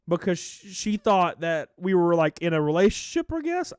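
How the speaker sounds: ordinary talk, nothing marked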